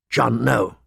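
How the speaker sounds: ordinary delivery, nothing marked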